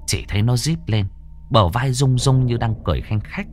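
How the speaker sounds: tense, lively storytelling voice; horror story narration. A narrator's reading. Narrator telling a ghost story to listeners, tense storytelling. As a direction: pull listeners into the haunted boarding house.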